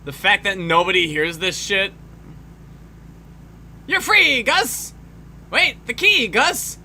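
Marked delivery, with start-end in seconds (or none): 3.9-6.8 calling out. Pretend. Voicing character calling to Gus.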